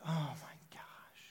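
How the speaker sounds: slow, breathless whisper; whispered reaction. Retold or imitated. Pastor whispering his shock at the woman's pain. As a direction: murmur it, stunned.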